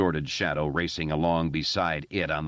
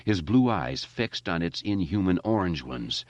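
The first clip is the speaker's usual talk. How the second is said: plain reading voice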